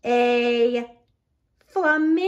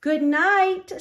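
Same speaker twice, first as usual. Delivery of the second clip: high, gentle goodnight; moon saying good night; 9 semitones higher, 4 dB louder than usual. A narrator's reading. The moon bidding the ducks good night, raised high. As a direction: sing out a sleepy goodnight. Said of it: "says the moon".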